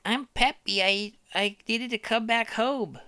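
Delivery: put-on high, slow, earnest baby. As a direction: explain like a tiny creature. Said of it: "He's like".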